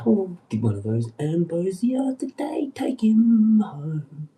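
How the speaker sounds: loud, high cocky catcall; brash mocking heckle. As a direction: heckle the stalker lady brashly. Catcalling stalker lady.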